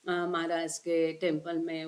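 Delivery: ordinary talk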